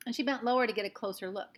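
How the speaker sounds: ordinary delivery, nothing marked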